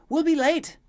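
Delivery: high, very loud cry of alarm; urgent yell. Acted. Maid tugging the prince's arm, urgent high yell. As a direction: shout it to get them moving. Dragging the prince, hurrying him.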